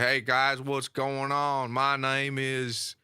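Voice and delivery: slow, stretched-out Southern impression; Southern accent. Shown as a demonstration. Showing friends on request, Southern accent. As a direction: draw the words out in a Southern accent. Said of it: "do a Southern accent if you can".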